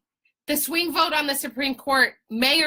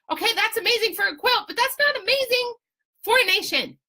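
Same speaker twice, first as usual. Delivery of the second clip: incredulous rant; 11 semitones higher than usual. Real, not acted. Exasperated quilt comparison.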